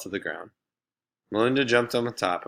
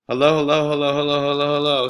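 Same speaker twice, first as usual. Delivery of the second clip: rapid, higher, louder hellos; fast as she can; 6 semitones higher, 7 dB louder, faster than usual. Acted. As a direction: rattle them off to annoy him. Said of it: "said Laurie as fast as she could".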